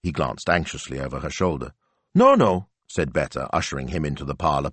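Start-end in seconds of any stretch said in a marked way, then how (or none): 2.1-2.7 high, louder quick no-no; quick reassurance. A narrator's reading. Betta waving off the guest's apology, high and quick. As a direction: brush the worry away brightly.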